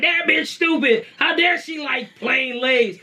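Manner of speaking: high-pitched mock indignation, slowing at the end; mock outrage, dragging out the last words; outraged commenter. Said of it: "So don't be coming like".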